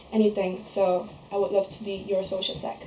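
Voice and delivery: ordinary delivery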